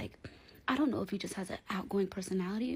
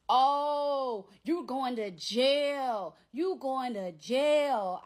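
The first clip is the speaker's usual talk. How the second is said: loud, high, drawn-out mock threat; taunting threat; 6 semitones higher, 6 dB louder, much slower than usual. Ex imitated yelling down the phone that she's going to jail, loud taunt. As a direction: stretch it out like a noisy taunt.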